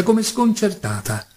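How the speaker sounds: usual speaking voice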